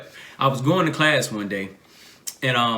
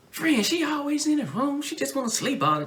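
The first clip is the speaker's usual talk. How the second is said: put-on girly voice; 13 semitones higher, 4 dB quieter than usual. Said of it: "answer from her line sisters".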